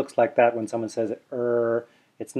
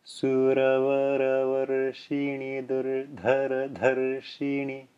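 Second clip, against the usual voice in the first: slow Sanskrit recitation with tapped r sounds, a touch softer; 5 dB quieter than usual. Shown as a demonstration. Teacher repeating the Sanskrit line slowly for students. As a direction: read it slowly again, each r a light tongue tap. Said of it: "Again slowly".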